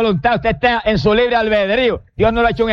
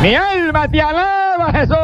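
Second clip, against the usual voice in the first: much higher, slow cry of praise; preacher's rapturous praise; fervent worship cry; 6 semitones higher than usual. Real, not acted. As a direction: pour it out to Jesus.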